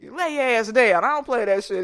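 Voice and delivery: loud stern scolding, pitch up; granny scolding. Retold or imitated. Granny telling off the boy, a loud stern scold. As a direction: bark it like a granny who won't take disrespect.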